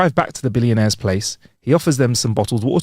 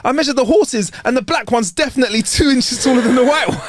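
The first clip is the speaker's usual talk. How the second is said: overjoyed shout; 13 semitones higher than usual. Acted. Guy tells the bartender his discovery, high triumphant shout. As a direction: announce it like big news.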